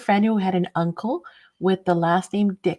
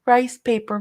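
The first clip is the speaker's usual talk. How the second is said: ordinary talk, nothing marked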